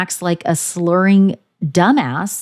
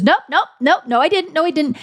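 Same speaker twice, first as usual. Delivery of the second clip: higher, rapid-fire denial; mock denial; 9 semitones higher than usual. Kristen denying she said it, a mocking higher denial. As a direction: brush it off in a hurry. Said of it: "she goes".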